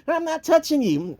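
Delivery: put-on very high, fast whiny kid voice; little brother Russell. Little brother defending himself to Bill, high whine. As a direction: squeak it out like a tattling little brother.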